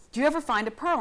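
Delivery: high, eager asking; mock-hopeful pitch up; hopeful question. Reporter imitating herself asking scientist about pearls.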